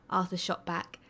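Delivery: ordinary delivery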